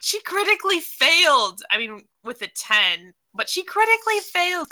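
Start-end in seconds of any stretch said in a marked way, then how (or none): 0.0-1.6 higher, louder dismay. Real, not acted. Player reacts to bad roll. 3.3-4.7 much higher dismayed wail; exaggerated dismay. Real, not acted. Player reacts to bad roll.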